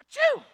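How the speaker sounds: loud, high comic sneeze; big hammed-up achoo; fake sneeze. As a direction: explode into a mock sneeze. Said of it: "he can't stop sneezing".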